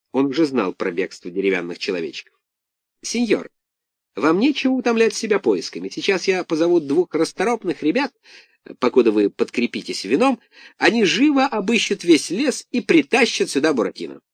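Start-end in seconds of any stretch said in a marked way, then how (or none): 3.0-14.2 much higher, obsequious innkeeper; a fawning tavern keeper pleading. A narrator's reading. Innkeeper speaks to Karabas.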